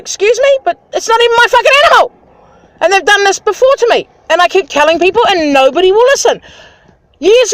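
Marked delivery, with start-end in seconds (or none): 0.0-2.1 high, fast indignant snap back; mock outrage at being blamed; indignant retort. To officials blaming her, indignant. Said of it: "And I'm like".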